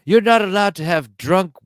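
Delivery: mock hotel staff. Friends joking about a Batman movie scene, prim hotel manager voice. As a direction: play the prim manager scolding a guest. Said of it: "Bruce Wayne is like".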